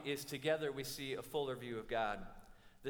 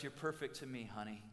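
soft, low, much quieter sweet-talk; tender aside; 4 semitones lower, 7 dB quieter than usual. Retold or imitated. Groom leaning over to soothe the bride, soft and low. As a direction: murmur it lovingly to your bride.

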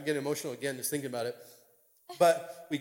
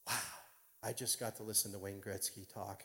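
hushed, low wonder; soft, low murmur of awe; awestruck; 6 semitones lower, 14 dB quieter than usual. Retold or imitated. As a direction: marvel softly to yourself. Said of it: "I'm just in awe".

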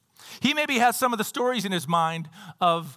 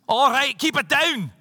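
higher, louder mock Scottish; Scottish accent; 5 semitones higher, 4 dB louder than usual. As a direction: put on a Scottish accent to hush the waves. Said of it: "If he was Scottish, he would have said".

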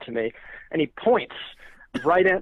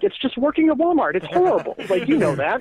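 pitched much higher, exasperated; exasperated rant; 8 semitones higher than usual. Retold or imitated. To the Walmart manager, a high exasperated rant. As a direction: plead how awful it is. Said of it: "just like".

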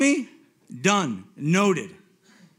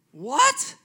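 a sharp high what, stunned; astonished; 9 semitones higher, 3 dB louder than usual. Retold or imitated. Man hearing that God loves him, stunned what. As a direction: blurt it out in shock.

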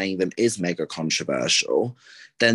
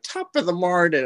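mocking stage-Irish lilt, pitched up; cliche Irish accent; 8 semitones higher than usual. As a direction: ham up a tourist's fake Irish. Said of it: "the whole cliche".

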